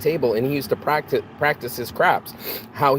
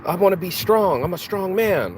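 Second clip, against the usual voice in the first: higher, puffed-up imitation of a boaster; mock tough-guy boast; 6 semitones higher than usual. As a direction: lightly ham up a man bragging he's strong. Mocking a boastful drinker.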